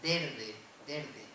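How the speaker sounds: "derde" with the S turned into a tapped R, said twice; "derde", the S changed to R as in the old Murcian way. Shown as a demonstration. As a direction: say "derde" with an R where the S was. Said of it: "Y se decía".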